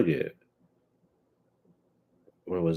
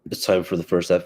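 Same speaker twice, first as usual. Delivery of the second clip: ordinary delivery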